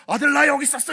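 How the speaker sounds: high, loud, open-armed call to his son; a father greeting his son with delight; loud, beaming dad voice; welcoming father. Pretend. Father voiced opening arms.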